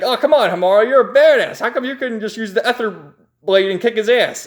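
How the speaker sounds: high, mock-exasperated hero voice; acted pep talk to a let-down friend; exasperated chiding. Hero chides captured friend.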